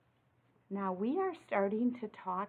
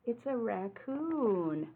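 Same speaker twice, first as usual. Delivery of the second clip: ordinary delivery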